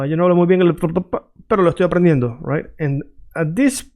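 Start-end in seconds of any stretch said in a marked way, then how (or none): 0.0-2.4 rapid Spanish that trips mid-phrase; hurried demo with a stutter; fast, getting stuck. Shown as a demonstration. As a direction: rush it and stumble in the middle. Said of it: "when you say it fast, you will get stuck".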